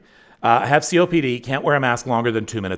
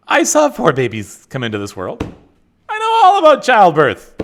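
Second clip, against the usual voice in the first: mock boast; 8 semitones higher, 8 dB louder than usual. Mock boast after viewer challenge.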